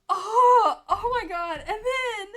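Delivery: loud, high, breaking into sobs; overwhelmed, crying out in shock; real crying. Real, not acted. Reacting to father fight scene.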